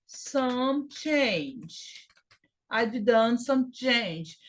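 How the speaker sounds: very slow repeated practice phrase; slow drill. Shown as a demonstration. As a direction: drill it slowly for students to copy. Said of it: "teacher drills a phrase slowly".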